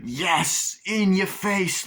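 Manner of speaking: high, drawn-out gloat; mock triumph. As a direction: crow over a beaten rival. Acts Chucky gloating at Tiffany.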